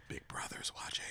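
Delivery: whispered spooky warning; whispering. Acted. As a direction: whisper it like someone's watching. Joke about surveillance.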